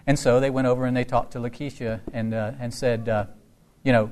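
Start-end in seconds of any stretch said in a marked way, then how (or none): none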